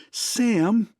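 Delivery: high, sharp angry cry of his name. A narrator's reading. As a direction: stamp and cry out at him. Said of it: "she cried angrily".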